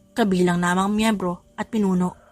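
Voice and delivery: normal talking voice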